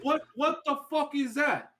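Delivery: high, indignant mock-Italian accent; Italian mafioso ghost. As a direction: complain like a hammed-up New York Italian. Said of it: "like, Italian, Italian ghosts".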